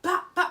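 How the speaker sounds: loud, high mock slap sounds; slapping noises. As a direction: bash out the hits as sounds. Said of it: "I was like".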